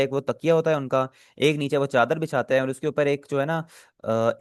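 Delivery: normal talking voice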